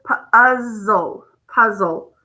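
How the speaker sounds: "puzzle" stretched into p, uh-zzle, then said whole; short vowel. Shown as a demonstration. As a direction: sound out "puzzle" slowly, hold the z, then blend it.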